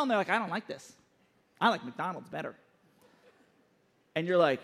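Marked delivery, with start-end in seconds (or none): 0.3-2.5 lightly voiced complaining child, low and soft; a quiet, sulky mock-child refusal; picky child. Child turning down a parent's home-cooked meal, lightly voiced complaint.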